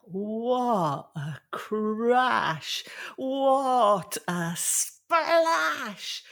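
very slow, high, stretched-out exclamations; booming exclamation. A narrator's reading. Storyteller marvels as the giant falls into the sea, drawn-out exclaiming. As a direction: draw out each sound like a huge impact. Narrating the giant's huge fall.

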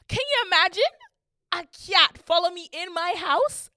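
high, loud, incredulous appeal; worked-up storyteller's indignation; scandalized disbelief. Retold or imitated. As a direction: demand that they share your outrage. Outraged appeal to the audience.

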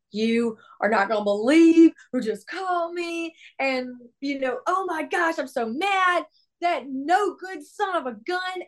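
high-pitched mock rant; retold angry yelling. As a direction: act out venting to a bandmate, pitch climbing. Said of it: "I was yelling like that".

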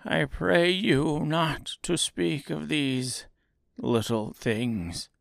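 high, slow self-pitying plea; pleading little man. A narrator's reading. Oz fearing being found out, slow plea.

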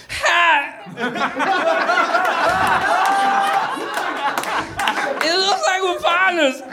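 high, giddy goofball voice; goofy high voice. Acted. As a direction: squeal it out like an overexcited doofus. Zed greets his new partner.

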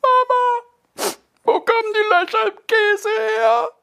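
put-on echoing plea of a little boy; little boy calling out. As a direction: wail the question up to the sky like a small boy. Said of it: "weithin hallend ruft".

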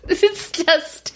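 high laughing voice; words squeezed out through a laugh. Real, not acted. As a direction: break into laughter as you speak. Laughs while trying to go on.

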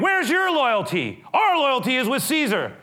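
high, forceful demand; accusing challenge. Retold or imitated. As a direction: imitate a crowd challenging loyalty. Crowd challenges Pilate's loyalty.

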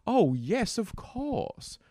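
high, airs-and-graces Southern gentry voice; hammed-up Southern aristocrat with dropped r's; Southern aristocratic accent. Shown as a demonstration. Aristocrat pondering where to find a hamburger, lofty Southern accent. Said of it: "Aristocratic accent is".

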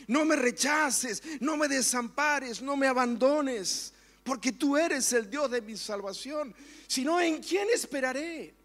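high, loud, pleading preaching that sinks to a hush at the end; fervent preaching prayer. Acted. Pastor prays David's words before the church, fervent then hushed.

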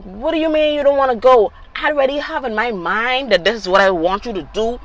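high, loud indignant protest; retold anger. Mom retelling her outburst at son, indignant anger. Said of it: "I was so mad".

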